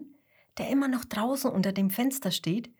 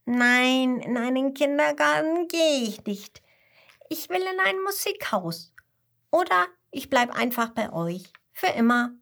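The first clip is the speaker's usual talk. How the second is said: put-on high, insistent child refusal; stubborn little koala; 4 semitones higher than usual. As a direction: plead and protest like a small child digging in.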